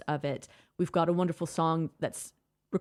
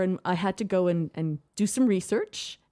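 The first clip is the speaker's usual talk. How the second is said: ordinary talk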